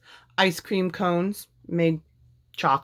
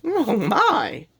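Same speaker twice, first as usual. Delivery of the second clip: amused; 6 semitones higher than usual. A narrator's reading. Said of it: "said Minnie with a smile".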